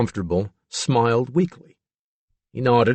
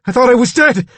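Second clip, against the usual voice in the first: high, loud cry of disbelief; shocked, high-pitched shouting; panicked cry; 13 semitones higher, 8 dB louder than usual. A narrator's reading. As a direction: blurt it out in terror. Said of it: "he cried".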